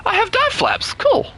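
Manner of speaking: very high sarcastic squeak. Real, not acted. Sarcastic joke about equipment.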